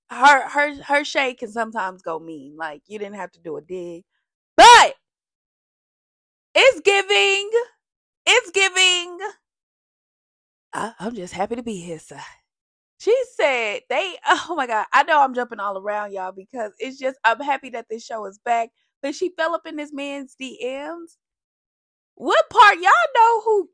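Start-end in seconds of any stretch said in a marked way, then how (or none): 4.5-9.2 excited exclaim. Real, not acted. 22.1-23.7 excited gossip. Real, not acted.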